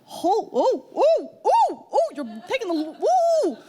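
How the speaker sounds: high, loud, sputtering grandma imitation; a hammed-up little old lady losing it; mock cursing outburst. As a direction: imitate grandma blowing up in outrage. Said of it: "We found out loudly".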